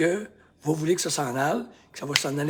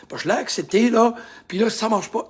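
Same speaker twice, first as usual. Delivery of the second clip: louder, higher, exasperated; mounting frustration; 7 semitones higher, 7 dB louder than usual. Shown as a demonstration. Coach acting out disappointment, voice rising. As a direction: get worked up that it fails. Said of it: "Là, je vous le fais physiquement".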